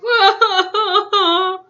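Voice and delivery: high loud crying burst; a wailing sob-laugh; high sobbing laugh. Acted. Sound alone.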